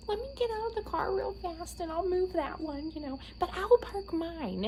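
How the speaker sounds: high, doting mom voice; imitating mom. Imitating mom teasing about the date, high doting. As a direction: tease sweetly like a proud mother. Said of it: "she was like".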